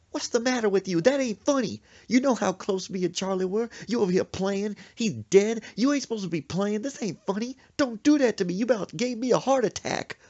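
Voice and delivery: loud, high, rapid-fire telling-off; indignant scolding mother. Retold or imitated. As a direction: lay into your son after his prank. Mother scolds son, hitting him.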